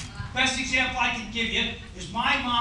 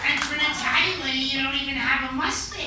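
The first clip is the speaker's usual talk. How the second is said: lightly voiced blunt mother; a hint of a tactless old mom; Irish mom. Mom insulting the Italian mother-in-law, mildly voiced. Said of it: "She's like".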